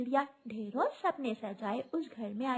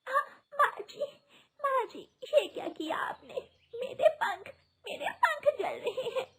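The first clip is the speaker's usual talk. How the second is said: high, crying out in pain; 13 semitones higher than usual. Acted. Bride burned by tea, high pained crying. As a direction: wail as burning tea hits. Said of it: "रानो चिड़िया रोने लगती है".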